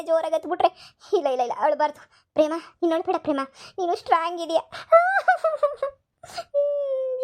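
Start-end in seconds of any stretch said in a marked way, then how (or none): 4.6-5.9 laugh-sob. Acted. Comic crying aside. 6.3-7.2 whimpering sob. Acted. Comic crying aside.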